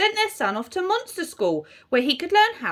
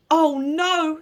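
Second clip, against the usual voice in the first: slightly raised, dismayed little voice; squeaky little werewolf; 4 semitones higher, 4 dB louder than usual. A narrator's reading. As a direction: lightly voice a squeaky young werewolf. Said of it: "with the squeakiest voice".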